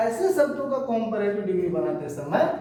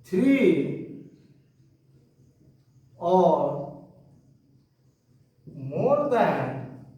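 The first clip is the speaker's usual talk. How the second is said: slow, word-by-word dictation of a rule; 4 semitones lower, much slower than usual. Shown as a demonstration. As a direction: spell the rule out slowly for students to copy. Teacher dictates rule slowly. (धीमे-धीमे लिखवाना)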